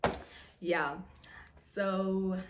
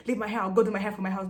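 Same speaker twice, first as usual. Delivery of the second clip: louder, indignant retort, acted out; mock telling-off; 8 dB louder, faster than usual. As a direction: act out snapping back loudly. Acting out telling her off.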